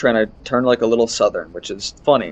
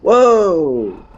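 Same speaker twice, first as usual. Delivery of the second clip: startled whoa; 5 semitones higher, 6 dB louder than usual. Real, not acted. Startled in game.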